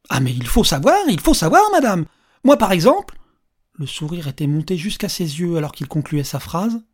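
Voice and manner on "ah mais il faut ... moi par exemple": loud, high mock scolding; playful insistence. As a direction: press the point theatrically. Old man playfully scolds the woman.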